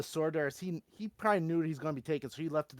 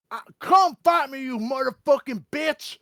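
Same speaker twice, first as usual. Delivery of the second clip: much higher and louder, full-throated scream; screaming challenge; 11 semitones higher, 15 dB louder, slower than usual. Acted. Furious cleric screams at Vecna outside, full-throated scream. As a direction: scream to the sky, daring the god to come. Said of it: "He's just screaming this".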